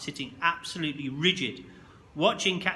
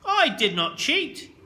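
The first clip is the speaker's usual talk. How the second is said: high loud slow furious denial; boy in blind fury shouting at his teacher; shouted back; 9 semitones higher, 6 dB louder, slower than usual. A narrator's reading. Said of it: "I shouted back at him".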